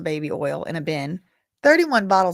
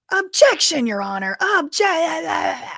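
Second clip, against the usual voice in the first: mock courtroom objection; 9 semitones higher, much slower than usual. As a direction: play the fed jumping up to object, shouting. Feds objecting, acted out loudly.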